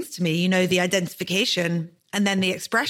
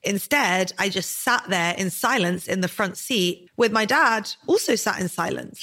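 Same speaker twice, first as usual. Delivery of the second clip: ordinary talk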